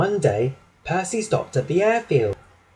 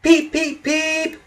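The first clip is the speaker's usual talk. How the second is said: high-pitched engine peeps; train whistle; 14 semitones higher, slower than usual. A narrator's reading. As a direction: toot like a little steam whistle. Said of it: "whistled Percy".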